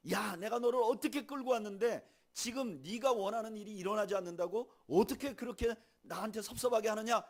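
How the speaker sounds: loud, high aggrieved reproach; reproachful scolding. Pretend. God imagined reproaching Elijah, loud and aggrieved. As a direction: scold him for being ungrateful. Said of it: "이렇게 말하지 않아요".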